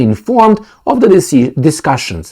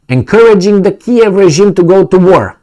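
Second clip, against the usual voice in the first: higher, louder mock voice of the Russian statement; mock Russian official; 8 semitones higher, 4 dB louder than usual. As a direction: ham up the Kremlin's indignant line. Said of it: "This is what the Russians tell Helsinki".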